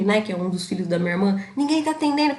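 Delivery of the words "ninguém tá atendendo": imitating anxious mother. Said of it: "Minha mãe já ligou puta e nervosa".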